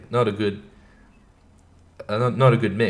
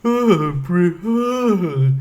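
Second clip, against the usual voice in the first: high, yawning groggy moan; hammed-up sleepy mumbling in a raised pitch; mock sleepy; 8 semitones higher, much slower than usual. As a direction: groan as if barely awake. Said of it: "being sleepy and like".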